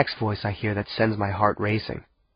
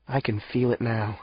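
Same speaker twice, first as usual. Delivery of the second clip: usual speaking voice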